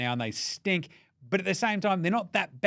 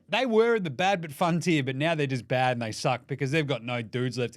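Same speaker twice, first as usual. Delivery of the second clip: ordinary talk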